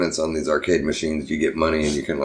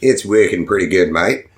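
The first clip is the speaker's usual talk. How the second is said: mock Australian accent, louder; 3 semitones higher, 5 dB louder than usual. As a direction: put on a jokey Australian accent as if a filter switched on. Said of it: "install a filter that makes him sound a little more Australian".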